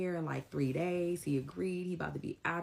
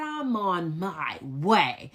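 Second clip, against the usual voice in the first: loud, very drawn-out defiant declaration; 4 semitones higher, 8 dB louder, much slower than usual. Retold or imitated. Tenant answering his threats on the phone, loudly defiant. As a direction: stretch each word out loudly, unbudging.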